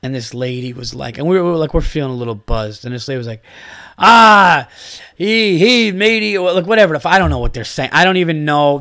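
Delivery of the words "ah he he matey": loud, high hammed-up old-English pirate voice; mock pirate talk. Mocking the fair lady's pirate act, hammed-up pirate voice. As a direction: ham up a fake medieval pirate accent. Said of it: "They were just doing that language".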